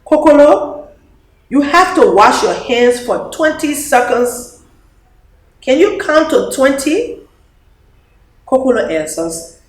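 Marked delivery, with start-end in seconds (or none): 0.0-7.2 put-on high, bright little-girl voice, eager; a small child coaching her friend; child's voice. Child Dede telling Kokolo to wash hands.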